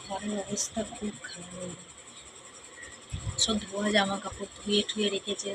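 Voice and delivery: speaking softly. Real, not acted. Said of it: "আমি আস্তে আস্তে কথা বলছি".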